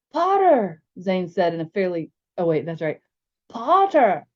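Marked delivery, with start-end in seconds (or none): 0.1-0.8 high, loud, hammed-up English accent; mock English accent. Zane teases James over his name, mock English accent. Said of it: "ridiculous attempt at an English accent". 3.5-4.3 high, loud, hammed-up English accent; mock English accent. Zane teases James over his name, mock English accent. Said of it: "ridiculous attempt at an English accent".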